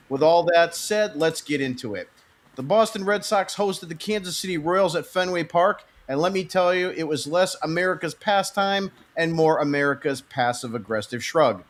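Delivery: usual speaking voice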